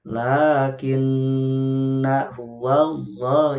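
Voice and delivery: Lakinna huwallah chanted slowly, na kept short; slow Quranic chant; correct recitation. Shown as a demonstration. Teacher repeating the verse correctly, slow chant. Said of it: "Jadi nanya pendek".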